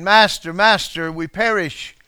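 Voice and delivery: frightened disciples calling out; panicked cry. Acted. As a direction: beg the sleeping teacher for help. Disciples crying out in storm.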